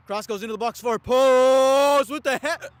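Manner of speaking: loud, high, stretched goal-chance call; excited shout. Real, not acted. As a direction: yell it out as the header goes in. Big chance header.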